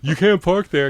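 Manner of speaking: retold cop yelling an order; barked order. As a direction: shout it like an angry officer.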